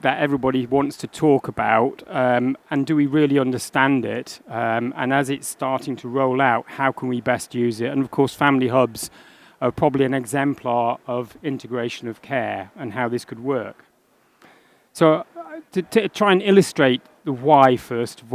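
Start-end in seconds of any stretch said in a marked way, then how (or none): none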